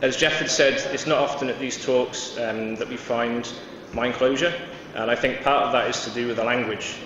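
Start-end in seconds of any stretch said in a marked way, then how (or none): none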